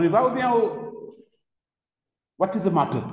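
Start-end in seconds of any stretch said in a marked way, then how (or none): 2.4-3.0 lightly voiced English speaker. Teacher teases the class in English, a light English-speaker voice. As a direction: toss the question off in English, like the English do. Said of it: "Comme les Anglais".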